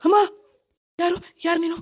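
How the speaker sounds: a scared man pleading with a ghost; frightened. Acted. Husband terrified by ghost. (ಭಯದಿಂದ ಗಾಬರಿಯಾಗಿ ಕೇಳುವುದು)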